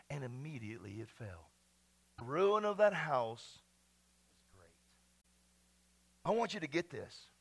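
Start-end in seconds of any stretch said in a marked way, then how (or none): 2.2-4.7 drawn out slowly for weight; slow solemn pronouncement; slow, weighty. Real, not acted. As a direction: let each word land slowly. Slow emphatic preaching line.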